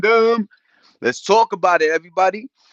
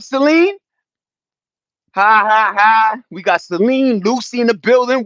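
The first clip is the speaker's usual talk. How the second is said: ordinary talk, nothing marked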